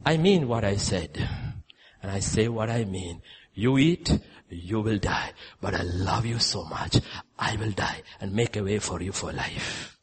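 low, softened, earnest voice; solemn loving assurance. Retold or imitated. God promising to die for man, low and tender. God's loving answer, quoted after devil.

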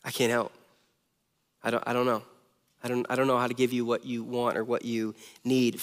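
soft, low, defeated I can't help; helpless admission. Pretend. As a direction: murmur it as if lost for words. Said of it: "Could you imagine being like".

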